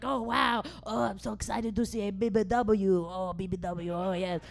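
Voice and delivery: put-on hammy Italian accent, lightly done; mock Italian accent with eager delight; excited Italian. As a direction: imitate an excited Italian man. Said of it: "He was so excited to see".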